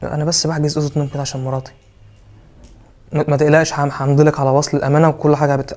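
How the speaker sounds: ordinary delivery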